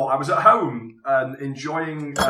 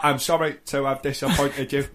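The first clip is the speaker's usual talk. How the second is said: ordinary delivery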